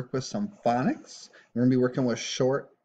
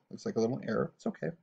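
usual speaking voice

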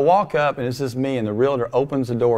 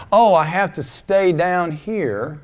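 higher, slow, drawn-out mock lament; mock self-abasement; 6 semitones higher, slower than usual. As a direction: play the unworthy soul, dragging it out. Voicing unworthy thoughts to congregation.

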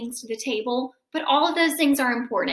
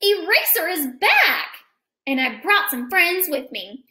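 lively picture-book read-aloud; reading to children; 4 semitones higher than usual. A narrator's reading. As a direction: read it to a class of kids.

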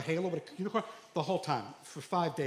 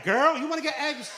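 put-on high, loud, laughing girlish call; now he calls others girl, laughing; sassy girl voice; 8 semitones higher, 5 dB louder than usual. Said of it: "I was like".

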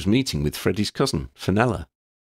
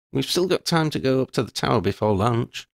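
put-on higher young voice, dry and fond; girl's voice, amused then serious; Lydia, wry schoolgirl; 7 semitones higher than usual. A narrator's reading. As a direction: tease her friend, then own up frankly. Lydia moves on.

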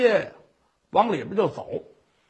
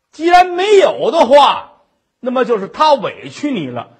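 put-on drunken fury; 4 dB louder than usual. Drunk husband ordering his wife to hang herself, loud and furious. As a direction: hammer out each order, ending in a yell.

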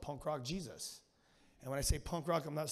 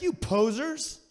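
mocking posers; 5 semitones higher, 5 dB louder, much slower than usual. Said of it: "He's like".

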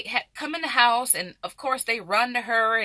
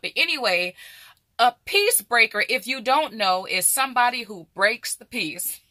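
ordinary talk, nothing marked

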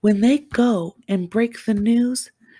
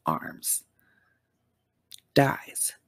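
a single dramatic whispered word; 11 semitones lower, 6 dB quieter than usual. Real, not acted. Whispering his death to her viewers. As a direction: drop to a breathy whisper for effect.

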